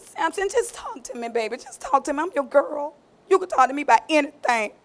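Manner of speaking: soft, sweet pillow talk; sweet coaxing. Acted. Delilah softly sweet-talking Samson to open up. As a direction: purr it to win him over.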